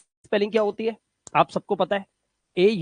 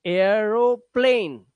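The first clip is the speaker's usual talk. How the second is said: Aeroplane drawn out slowly, part by part; slow teacher's model of aeroplane; slow careful demonstration; much slower than usual. Shown as a demonstration. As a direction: stretch out aeroplane for learners. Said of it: "ध्यान से देखना".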